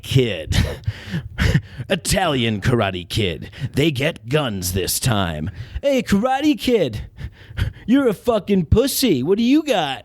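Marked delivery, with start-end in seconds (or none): 5.8-10.0 higher, jeering bully voice; mock him like a schoolyard bully; taunting thug. Italian Karate Kid taunting the old Karate Kid, jeering.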